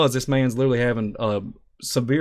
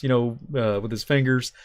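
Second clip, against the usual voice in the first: ordinary talk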